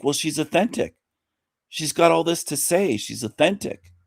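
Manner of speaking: mock believer, slightly high; imitating credulous viewers. Mimicking credulous channel viewers.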